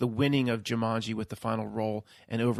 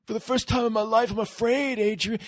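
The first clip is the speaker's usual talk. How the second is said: higher, hammed-up confession of fear; Rocky admitting fear; 10 semitones higher, 4 dB louder than usual. Rocky tells Adrian on the beach he is afraid, imitated high and strained. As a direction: cry it out like a fighter losing his nerve. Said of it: "And he".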